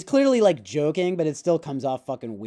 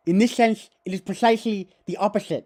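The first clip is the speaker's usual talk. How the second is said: put-on high, loud lisped foreign accent; emphatic philosopher caricature; Zizek; 6 semitones higher, 5 dB louder than usual. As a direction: proclaim it with hissing s sounds. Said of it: "He always does that shit".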